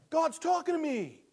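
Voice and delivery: high, loud exclamation of discovery; sudden realization. Acted. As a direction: burst out as if it just dawned on you.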